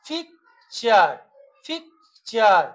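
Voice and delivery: পিকচার slowly split, পিক, চার; broken into syllables. Shown as a demonstration. Teacher showing students syllables, পিকচার in two slow parts. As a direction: pause between পিক and চার. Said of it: "দুবার উচ্চারণ করছি".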